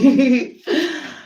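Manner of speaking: short high laugh of resignation; high rueful laugh. Real, not acted. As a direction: laugh it off helplessly. Laughing at having to manage.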